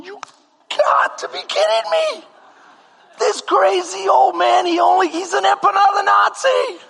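shouting in disbelief; outraged rant. Retold or imitated. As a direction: rant about the crazy old baker. Outraged at baker.

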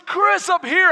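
high, loud call from above; God calling out. Retold or imitated. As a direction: holler down to get someone's attention. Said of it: "God has a Mexican accent".

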